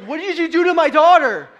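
loud, high mock outrage; hammed-up protective dad shout; outraged dad. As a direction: yell it like a horrified father. Dad exclaiming in retold shock.

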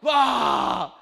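put-on a loud playful "wah" to startle the kids; boo-style scare shout; playful scare roar. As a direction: jump out with a mock roar. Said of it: "I'm like".

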